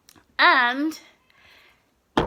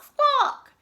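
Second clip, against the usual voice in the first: high squawk; high, shrill-pitched bird call; hen squawk; 14 semitones higher than usual. Acted.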